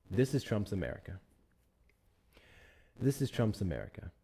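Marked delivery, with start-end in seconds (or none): none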